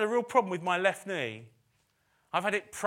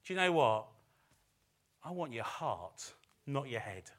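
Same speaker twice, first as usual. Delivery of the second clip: gentle voice of God; 6 semitones lower, 6 dB quieter than usual. Acted. Preacher voicing God asking the listener, gently and softly. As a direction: ask tenderly for their heart. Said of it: "God ... wants to ... say".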